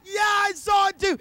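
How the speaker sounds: loud, high shouted reply; answering shout. Acted. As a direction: shout back agreement. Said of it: "they're screaming it to each other".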